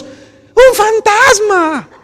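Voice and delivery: high, long, drawn-out scream of fright; frightened cry, very high and slow; terrified scream. Acted. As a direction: shriek it like a man seeing a ghost. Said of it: "gritaron estos hombres machos rudos".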